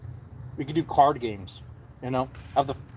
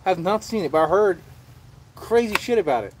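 ordinary talk, nothing marked

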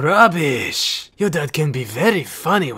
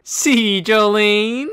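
usual speaking voice

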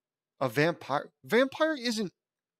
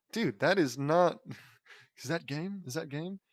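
ordinary delivery, nothing marked

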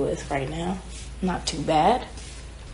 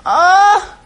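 loud, high startled exclamation; a real startled cry; sudden loud oh; 13 semitones higher, 12 dB louder than usual. Real, not acted. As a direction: blurt it out in surprise.

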